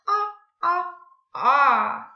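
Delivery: two short "Ah" sounds, then one longer, all loud; vowel sound demo. Shown as a demonstration. Teacher models the week's sound, loud separate "ah". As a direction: punch out "Ah" as a lone open vowel. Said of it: "the ah sound".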